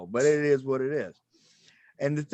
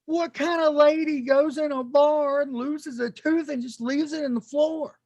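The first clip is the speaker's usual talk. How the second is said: incredulous thought; 12 semitones higher, 4 dB louder than usual. Retold or imitated. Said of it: "And I'm thinking".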